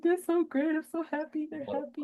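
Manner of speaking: high, soft teary gush. Retold or imitated. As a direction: squeak it through happy tears. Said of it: "You're like".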